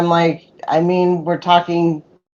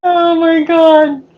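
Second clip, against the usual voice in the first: laughing; 10 semitones higher, 4 dB louder, much slower than usual. Real, not acted. Laughing fit after joke.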